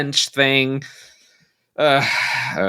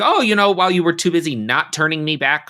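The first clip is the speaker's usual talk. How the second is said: Amy, aggrieved; 7 semitones higher than usual. Acted. Voices Amy's grievance from season six.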